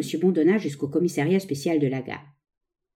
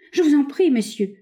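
higher-pitched, understated plea; mild stammering plea; 8 semitones higher, 3 dB louder than usual. A narrator's reading. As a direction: plead with a touch of a falter. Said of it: "balbutia monsieur Bondona".

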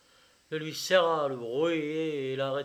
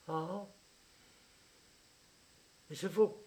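ordinary delivery

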